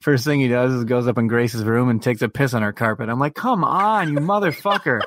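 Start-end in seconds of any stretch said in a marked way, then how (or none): 3.3-5.0 comic pleading curse at the dog; exasperated retold scolding. Owner finds dog peeing on carpet, retold exasperation.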